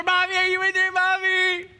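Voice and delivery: put-on high little-kid voice; sing-song child calling through door.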